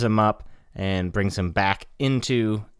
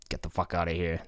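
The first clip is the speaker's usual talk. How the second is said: muttered quote; 3 semitones lower, 8 dB quieter than usual. Retold or imitated. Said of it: "And he's like".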